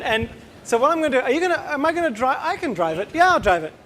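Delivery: ordinary delivery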